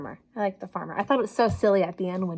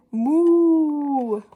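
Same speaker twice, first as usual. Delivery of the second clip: 1 big moo; 4 semitones higher, 4 dB louder than usual. A narrator's reading. Farmer lets out a big moo.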